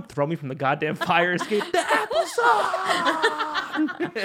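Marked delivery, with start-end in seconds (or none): 1.7-4.3 very high, slow, laughing squeal. Acted. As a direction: stretch it out in a shrieking giggle. Mocking shriek while laughing.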